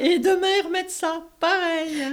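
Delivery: high, loud giggling scorn; mock the play through giggles; giggling mockery. Continues mocking, high.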